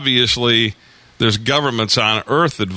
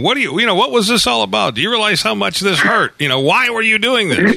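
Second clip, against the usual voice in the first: much higher, hammed-up indignant questioning; imagined angry demand; 10 semitones higher than usual. Host imagining confronting his abductors, a pitched-up, mock-outraged demand. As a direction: fire the questions back at them, mock outraged. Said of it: "I'd be biting back pretty hard".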